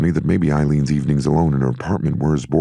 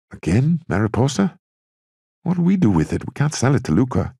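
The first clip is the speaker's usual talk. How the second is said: surprised; 11 semitones higher than usual. Acted. Cork voiced, surprised.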